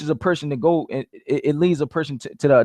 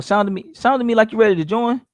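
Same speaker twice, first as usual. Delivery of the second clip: high voice with "join" swinging up; doubtful rising end on "join"; unsure upswing; 7 semitones higher than usual. Shown as a demonstration. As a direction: pitch it high and question "ready to join". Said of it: "It just seems like you're unsure".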